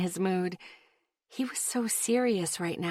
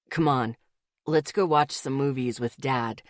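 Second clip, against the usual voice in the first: put-on lightly lowered young man's voice; easygoing guy, voiced a bit low; low male voice; 7 semitones lower than usual. A narrator's reading.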